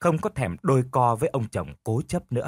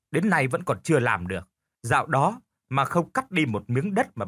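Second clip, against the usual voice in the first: sarcastic, higher and quick in places; mocking wife; 3 semitones higher than usual. Wife mocks her useless husband, sarcastic higher voice. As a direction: needle her husband with scorn. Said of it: "nói với cái giọng như mỉa mai chồng".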